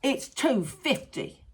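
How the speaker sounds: slow, deliberate demand; slow, curt driver. Retold or imitated. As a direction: spell out the fare slowly. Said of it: "He went".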